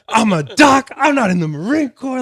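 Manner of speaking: high, loud indignant yelling; acted-out angry shouting; re-enacted screaming. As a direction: yell your rank at him in outrage. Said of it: "I'm just screaming at this guy".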